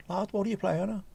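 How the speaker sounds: high, put-on Scouse voice; Scouse accent. Reading the viewer's question in Scouse. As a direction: ask it like a Liverpool lad. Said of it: "Now in Scouse".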